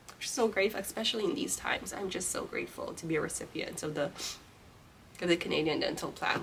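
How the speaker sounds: soft, choked-up thanks; quietly moved, almost tearful; emotional. Real, not acted. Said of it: "Sorry, I'm getting emotional right now".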